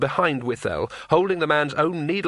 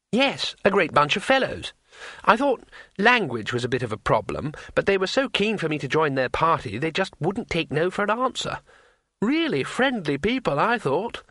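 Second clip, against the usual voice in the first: higher-pitched earnest tourist; naive, cheerful tourist; 4 semitones higher than usual. Acted. Tourist recalling his new friends, naively pleased. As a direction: chatter innocently in a high voice.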